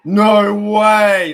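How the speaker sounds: very loud, high stunned yell; shocked shout. Real, not acted. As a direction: explode in disbelief. Live reaction to interception.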